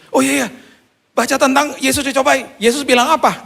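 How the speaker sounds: high, urgent realisation; acted-out excited panic; frantic recall. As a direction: blurt it out as it comes back. Acts out frantic dialogue with God.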